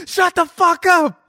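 high, slow yelled quote; mock yell. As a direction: bark it like a yell. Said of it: "She's yelling".

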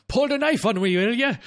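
high, loud Scottish constable voice; gruff Scottish policeman accent. Retold or imitated. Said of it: "Scottish constable".

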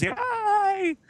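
squeaky high mock yay; hammed-up falsetto cheer; cartoon cheer. Persuader cheers.

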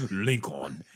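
"Lincoln" mimicked in the trailer's African accent, falling at the end, with a laugh; mocking the trailer's African accent, falling. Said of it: "Why the fuck he say it like that".

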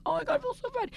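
high, soft, hammed-up dismay; mock-tearful oh my god; mock distress. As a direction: squeak it out like you're mortified. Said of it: "I was like".

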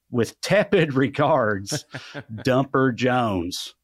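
drawn out, very slow; slow dramatic sign-off. Acted. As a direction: savor each word of the sign-off.

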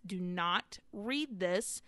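"Do not read this" drawn out slowly; slow emphatic warning. Retold or imitated.

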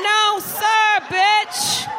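high, loud sassy refusal; sassy shout. Retold or imitated. Comic playing a sassy Jersey girl, high loud shout. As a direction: snap it back with full attitude. Mock sassy quote in bit.

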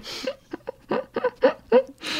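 high pained laugh after chili; laughing through the burn. Real, not acted. Just ate a very hot chili.

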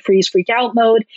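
ordinary talk, nothing marked

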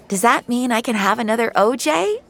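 put-on high, eager little girl; grinning kid. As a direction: ask with a big hopeful grin. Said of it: "with a big grin".

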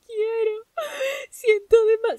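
high, loud mock-crying whine; whiny crying. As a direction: wail like a sulky child who won't sleep. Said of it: "Y Lucrecia".

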